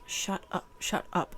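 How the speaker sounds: unvoiced whispered shut up; whispered spirit telling her shut up. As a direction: hiss it like a hostile spirit. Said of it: "an energy that's like".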